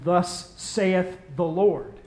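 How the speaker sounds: slow, solemn biblical proclamation; prophet's pronouncement. Retold or imitated. John the Baptist ending his prophecy, slow solemn intoning. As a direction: intone it slowly like an old prophet. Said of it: "add a little thing at the end".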